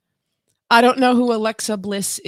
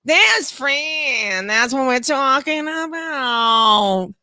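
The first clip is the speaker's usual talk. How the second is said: nasal, drawn-out whine in imitation; Fran; much slower than usual. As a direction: wail it slowly through the nose, hammed up. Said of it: "Fran was a little more like".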